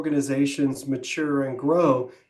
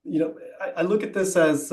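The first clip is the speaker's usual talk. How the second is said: ordinary delivery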